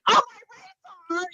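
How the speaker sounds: very high, drawn-out mock panic; hammed-up freak-out, slow high squeal. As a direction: play someone terrified to quote their own price. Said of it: "freaking out by it".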